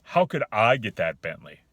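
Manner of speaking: loud, dismissive rhetorical question; disbelieving tone. Shown as a demonstration. Man rejecting the offered Bentley, disbelieving. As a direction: throw it out as a challenge, not a question. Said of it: "You hear the differences?".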